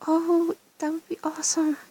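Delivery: delighted high rise on "Oh"; thrilled at the thought, voice jumping up; excited. Real, not acted. Player delighted at getting the machine, excited.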